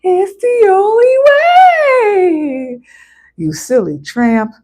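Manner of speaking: high, slow, drawn-out cartoon villain voice; Adventure Time villain. Quoting a kids' show villain in a high, slow drawl. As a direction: stretch it out like a sneering cartoon baddie. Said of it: "from Adventure Time".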